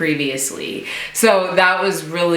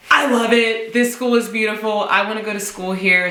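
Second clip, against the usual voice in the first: higher, quick excited gush; thrilled, pitched up and hurried; 5 semitones higher, faster than usual. Retold or imitated. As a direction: rush it out, totally in love with the place. Gushing about the school tour.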